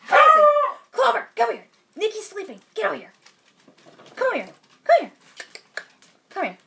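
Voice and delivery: short, insistent high calls to a pet; calling the dog. Real, not acted. Owner calling her dog away from someone sleeping, high insistent calls.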